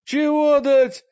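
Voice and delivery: high, slow indignant question; offended. A narrator's reading. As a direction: act insulted. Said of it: "переспросил Мрак оскорблённо".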